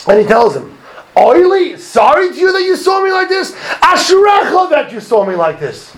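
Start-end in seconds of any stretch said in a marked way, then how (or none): 1.2-5.9 high, anguished retold outcry; retold screaming. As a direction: wail it out high, imitating his scream.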